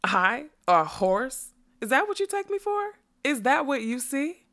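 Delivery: high, offended outburst; rising, affronted questions; indignant demand. A narrator's reading. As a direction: demand answers as an insulted unicorn. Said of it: "she demanded".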